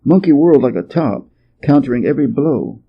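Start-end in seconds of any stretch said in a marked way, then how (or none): none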